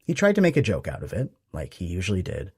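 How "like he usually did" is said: plain reading voice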